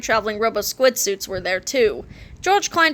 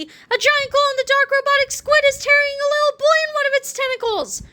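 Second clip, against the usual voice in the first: put-on high, quavering old lady; 14 semitones higher than usual. A narrator's reading. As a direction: play a dotty old woman, high.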